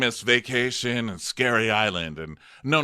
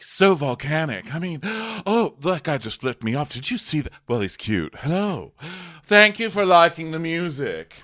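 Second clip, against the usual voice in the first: mock Luann; 7 semitones higher, 4 dB louder than usual. Host imitating Luann driving, lightly hammed-up diva voice. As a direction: lightly ham up the starry-eyed celebrity.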